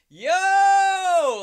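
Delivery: high loud far-off yo; calling out. Pretend. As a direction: shout from far in the back. Tooth calling from the back.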